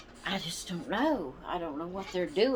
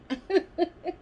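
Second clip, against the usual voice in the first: light laugh; 11 semitones higher, 4 dB louder than usual. Real, not acted. Laughs at her own joke.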